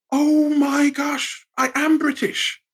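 slow, high "oh my gosh" in wonder; dawning realization. Retold or imitated. Watching the Olympic opening ceremony, slow high amazement. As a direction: draw it out as it finally clicks.

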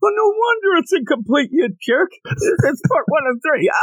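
very high-pitched mock angry yell; hammed-up indignant listener in a high squawk. Angry listener scolding the hosts over part one, mock yelling. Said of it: "people are gonna yell at us".

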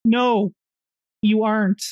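normal talking voice

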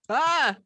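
high battle yell; karate yell. Acted. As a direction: shout a martial arts cry. Karate yell while fighting.

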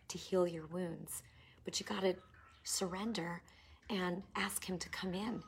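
hushed and choked up; tender, overcome with feeling; emotional. Real, not acted. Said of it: "I get emotional".

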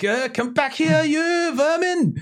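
high, slow, hammy shout; angry chaser. Acted. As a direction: bellow it after the fleeing thief.

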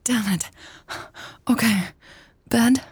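high-pitched plea between labored breaths; winded, pleading, broken by breaths; breathless pleading. A narrator's reading. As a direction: pant between the words and give in. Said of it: "pleaded between labored breaths".

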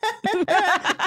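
high shrieking burst of laughter; a sudden high giggle fit; high squealing laugh. Real, not acted. Laughing at ear freak joke.

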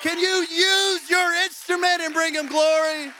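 loud, high rallying call to stand and worship; preaching at full force. Acted. Pastor urging the church to stand, loud rallying cry. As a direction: whip the room up into praise.

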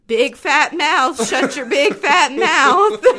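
slow, drawn-out scolding of herself; slow self-scolding. Retold or imitated. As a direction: berate yourself word by word. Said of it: "because you're like".

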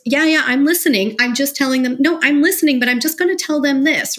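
loud, high, eager yeah-yeah-I'm-listening; eager talk-over. Retold or imitated. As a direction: brush them off while charging ahead with your own point. Acting out family cross-talk.

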